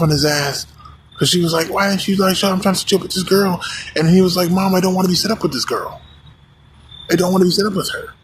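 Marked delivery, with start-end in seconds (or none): 1.7-3.6 mom going off. Retold or imitated. 4.5-8.1 fed-up son. Retold or imitated. Son refusing mom's setup.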